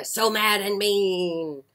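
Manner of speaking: lively picture-book read-aloud; bright storytime reading; reading to children. A narrator's reading.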